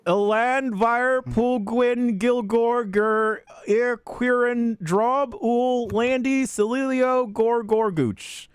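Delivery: slow, high, syllable by syllable Elandvir Pulgwin Gilgor Gur Irquirin; careful reading of names. Shown as a demonstration. Host forced to read strange names, slow and careful. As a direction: sound out each odd name slowly. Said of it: "there's a phonetic pronunciation".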